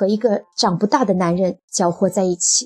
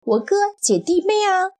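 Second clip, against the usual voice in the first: exasperated explanation; 9 semitones higher than usual. A narrator's reading. Husband defends himself in quarrel.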